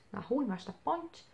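normal talking voice

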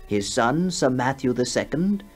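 ordinary delivery

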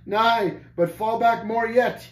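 high, bold command. A narrator's reading. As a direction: order the enemy back mid-fight. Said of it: "he commanded".

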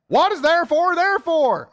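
high, loud southern delivery; southern accent. Shown as a demonstration. Preacher repeating the catchphrase for church, southern accent. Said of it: "say it with a southern accent".

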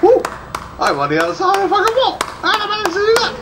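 high, fast, real disbelief; astonished shout. Real, not acted. Streamer stunned to end up through the wall, high fast astonishment. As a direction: blurt it out in shock.

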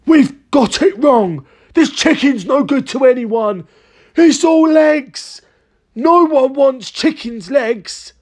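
high, slow, indignant wail; dismayed cry. A narrator's reading. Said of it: "cried Mister Killycranky".

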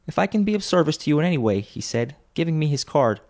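high, animated father's voice; earnest Bob quoting. A narrator's reading. Bob quotes the nephew's sympathy to his wife, animated and earnest. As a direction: retell the kind words with lifted pitch.

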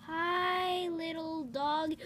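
usual speaking voice

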